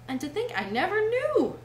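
high, dreamy wondering; dreamy lament. A narrator's reading. Toad marvels at his wasted years, high dreamy lament. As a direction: muse in a high, entranced voice. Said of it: "in a dreary monotone".

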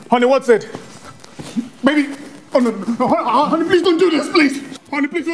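loud, rising cries of alarm; panicked pleading. Acted. Husband as his wife collapses before him, panicked pleading. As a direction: call out frantically, voice climbing.

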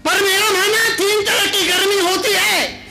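high and loud pulpit cry; booming sermon line; preaching shout. Shown as a demonstration. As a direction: proclaim it to the whole hall. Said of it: "repeats the line with force".

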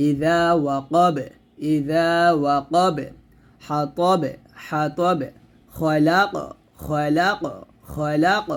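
slow recitation, final ব and ক bounced in ওয়াকাব, খলাক; tajweed qalqala drill, each word slowly repeated; qalqala demo. Shown as a demonstration. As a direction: echo the last consonant of হাতাব with a light rebound. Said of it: "এরকম".

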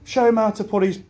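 a light take on a flustered teacher; old teacher's fuss. Retold or imitated. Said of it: "she says".

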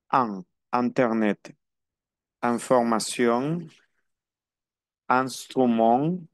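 internet, Información, Instrument slow, in said as a nasal an. Shown as a demonstration. Teacher drilling the French nasal in, slowly. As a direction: draw out each in as a nasal an. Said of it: "I N que también es an".

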